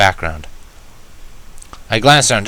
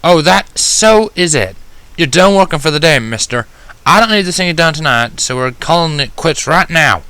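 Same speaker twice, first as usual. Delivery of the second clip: firm Applejack voice; 7 semitones higher, 4 dB louder than usual. Acted. Applejack orders the injured Mender to stop work, stern and loud. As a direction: lay down the law, no arguing. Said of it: "she announced abruptly".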